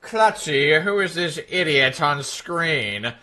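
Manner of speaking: slow villain voice, veering into Skeletor; Doctor Evil. Acted. Streamer trying a requested impression, slow villain voice. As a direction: drag it out like a cartoon villain. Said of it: "That sounded more like Skeletor".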